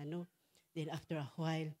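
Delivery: usual speaking voice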